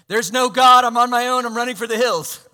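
high, loud panicked cry; the heart's loud panicked voice. Retold or imitated. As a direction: shout it like you're fleeing in terror. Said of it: "for my heart to say".

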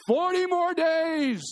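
high, slow, strained reluctant prophet; imitating a reluctant Jonah; Jonah muttering. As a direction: drag the words out grudgingly. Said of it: "he's saying underneath his breath".

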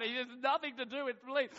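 normal talking voice

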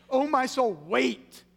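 very loud, urgent order to your own soul; commanding himself. Acted. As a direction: push "Oh, my soul" high and hard. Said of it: "he has to command himself to do it".

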